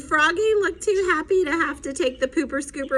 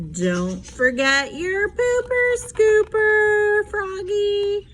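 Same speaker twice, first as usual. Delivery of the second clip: high, slow teasing mom; sing-song reminder; 7 semitones higher, slower than usual. Acted. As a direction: mom handing Froggy a toy shovel, higher. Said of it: "Mom held out ... and said".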